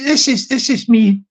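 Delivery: normal talking voice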